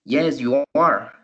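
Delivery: yes, you are, said slowly; slow model answer. Shown as a demonstration. Teacher modelling the answer for students, slow and clear. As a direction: lay out the answer slowly for learners. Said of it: "The answer would be".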